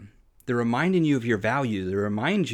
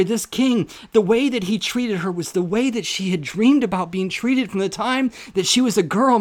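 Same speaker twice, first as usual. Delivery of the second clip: loud, high, impassioned preaching; preaching at full force; 10 semitones higher, 7 dB louder than usual. Real, not acted. Preacher telling of the king's love, loud impassioned preaching. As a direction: preach it with rising fire.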